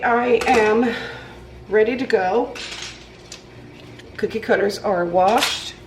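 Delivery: ordinary talk, nothing marked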